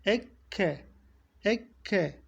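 Ekke with a clean doubled k; Ekke, clean kef; pure kef. Shown as a demonstration. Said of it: "safi bir şekilde çıkarmamız gerekiyor".

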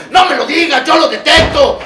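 fierce rebuke; angry shout. Acted. Angrily scolding his own denial.

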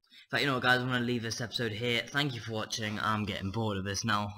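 loud, upbeat sign-off; YouTuber outro. Real, not acted. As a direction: pump it up for the outro.

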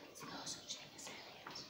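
quick secret whisper; quick conspiratorial whisper. Acted. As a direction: hush it like a secret to the kids. Mocks Bob's disbelief in story.

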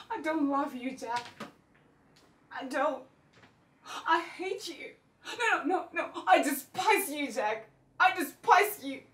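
increasingly loud, agitated denunciation; building fury. Acted. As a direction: let the hatred build to a shout. Acted rant at estranged husband, building.

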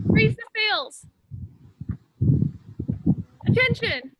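put-on calling out commands to a room of kids; game caller. Leader running Captain's Orders game with kids, called out.

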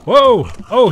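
sudden loud alarmed cry; startled shout. Real, not acted. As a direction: jump back with a loud whoa. Creature attacks suddenly.

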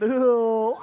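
loud, put-on goofy Ew; a loud, grossed-out country boy grunt; country boy dummy voice. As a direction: blurt it like a dopey puppet. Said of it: "no, I do the voice".

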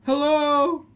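loud high panicked yells of fright; real terrified shouts; startled yelling. Real, not acted. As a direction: scream out at the jump scare. Jump scare in horror game.

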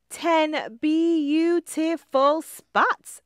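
high, slow, delighted "Ten beautiful spots"; high slow ladybug voice savouring each word; proud ladybug voice. A narrator's reading. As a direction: admire yourself in the pond, drawn out. Lindy admiring her reflection.

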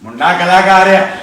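grumbling father voice, loud then low; imitating dad. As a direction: do your dad's grumbling voice. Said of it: "ਮੇਰੇ daddy ਦੀ ਸਾਂਗ".

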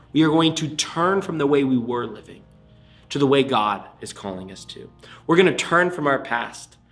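ordinary talk, nothing marked

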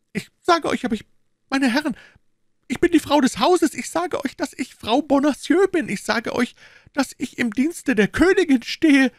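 a captured wife insisting on who she is, frantic; desperate woman crying out. A narrator's reading. Madame Bonacieux crying out to her interrogators, high and desperate. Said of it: "rief die unglückliche Frau".

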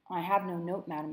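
usual speaking voice

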